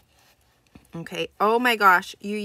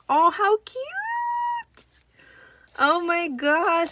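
much higher, louder squeal of delight, slow and drawn out; gushing, drawn-out excited shriek; 9 semitones higher, 7 dB louder, much slower than usual. Real, not acted. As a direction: squeal over the cute little tag. Squealing over cute mail.

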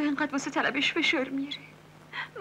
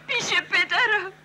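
slow, louder words broken by sobs; crying; 4 semitones higher, 4 dB louder, slower than usual. Acted. Girl tells her friend she is going home to her father, sobbing. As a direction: sob it out.

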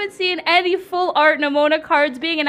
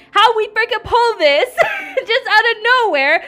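high giggling amazement; laughing disbelief; 11 semitones higher than usual. Real, not acted. Reacting to the lucky pull.

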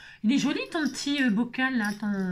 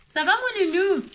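pet talk; 8 semitones higher, 4 dB louder than usual. Real, not acted. Talking to pet.

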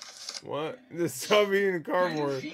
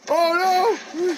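high, loud "oh no"; alarmed cry; 13 semitones higher, 9 dB louder than usual. Real, not acted. Startled by something on screen, loud alarmed cry. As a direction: yelp in sudden alarm.

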